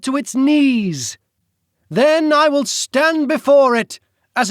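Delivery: high, loud, slow, theatrical British villain; grand villain speech. Acted. The Duke demanding Celine return, grandiose British villain. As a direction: proclaim it like a would-be king.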